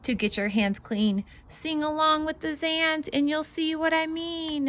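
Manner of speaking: lively, bouncy read-aloud voice; singsong picture book reading; reading to children. Acted. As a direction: read it out like a storybook for little ones.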